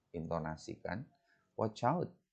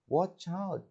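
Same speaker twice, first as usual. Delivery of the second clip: "Watch out" called out much higher, tune rising on out; rising warning; 11 semitones higher than usual. Shown as a demonstration. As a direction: warn of danger, pitch jumping up. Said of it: "justru naik".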